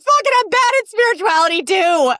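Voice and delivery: loud exasperated give-up shout; frustrated outburst. Pretend. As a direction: throw up your hands and yell. Imagined frustrated self.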